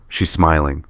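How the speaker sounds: usual speaking voice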